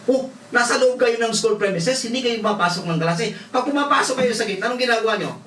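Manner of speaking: high, rising reproach; scolding. Retold or imitated. Pastor scolding truant students, high and sharp. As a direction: press them with pointed questions.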